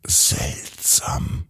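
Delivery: whispered to himself. A narrator's reading. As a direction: breathe it out, puzzled. Finn wonders aloud, whispered.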